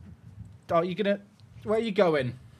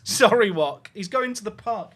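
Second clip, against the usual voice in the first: ordinary talk, nothing marked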